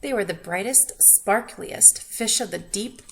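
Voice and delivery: ordinary delivery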